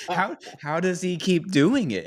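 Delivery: high, slow, laughing mock disbelief. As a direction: ask it in amazement, laughing through it. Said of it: "And I'm just like".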